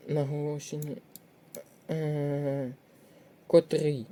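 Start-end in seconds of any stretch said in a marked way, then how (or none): 3.5-4.1 котрий stressed on final РИЙ; stress on second syllable. Shown as a demonstration. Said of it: "наголошений на другий склад".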